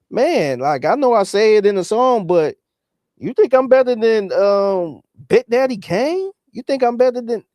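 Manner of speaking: high, disbelieving mock plea; hammed-up high voice of a modest star. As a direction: squeak out the question like a rapper begging for reassurance. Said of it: "Just imagine [name] was like".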